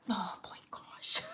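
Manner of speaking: slow, soft tearful whisper; crying quietly. Real, not acted. Vlogger whispering through tears after a hard week. Crying over viewers' support.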